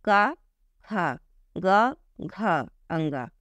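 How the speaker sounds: very slow, stretched का खा ग घ अंगा; slow syllable drill. Shown as a demonstration. Teacher drills syllables for students to copy, slowly. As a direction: draw each syllable out, breathy ones marked. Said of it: "बार बार बोल के check करिए".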